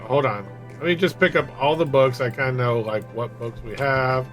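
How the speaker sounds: usual speaking voice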